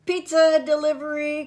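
high, loud, quick delivery call; loud announcing holler. A narrator's reading. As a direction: sing out the arrival to the whole yard. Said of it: "called an unfamiliar voice".

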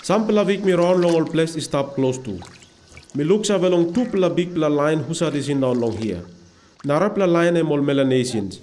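usual speaking voice

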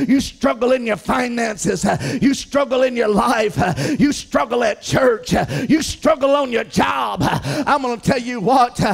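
loud, rising preacher's shout; full-force sermon cadence; fiery preaching. Acted. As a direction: preach it hard to the congregation.

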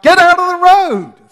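sudden very loud, high yell; shout. Retold or imitated. Father yelling at toddler son in the road, alarmed shout. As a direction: bellow the warning with full force. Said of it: "Or I could shout".

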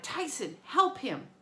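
shouting to a friend for help. Acted. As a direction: call out across the hill. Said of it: "Annabeth shouted".

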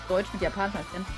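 normal talking voice

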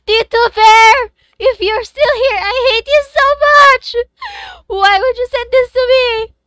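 high, loud, near-crying yell; furious wailing shout; anguished shout. Real, not acted. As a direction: scream at him half in tears. Screaming at friend over pull.

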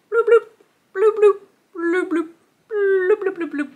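high, popping bloops; fish bubble noises. Acted. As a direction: bubble like a swimming fish, fading at the end.

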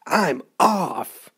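acted storybook shout of alarm; crying out. A narrator's reading. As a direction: cry it out as if fleeing. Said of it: "he cried".

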